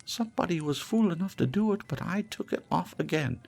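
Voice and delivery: scornful charwoman. Acted. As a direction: crow over the spoil, brazenly. Charwoman mocks dead man's shroud.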